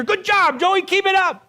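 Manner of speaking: high-pitched cheering praise; mock encouragement, high and eager; encouraging cheer. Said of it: "You want to hear".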